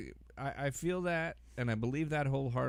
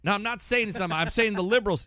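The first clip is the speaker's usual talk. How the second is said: much higher and louder, indignant pundit; whiny high protest; 10 semitones higher, 8 dB louder than usual. Pretend. Improv skit, playing Hannity protesting to his therapist, high and loud. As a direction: whine it like a cornered pundit.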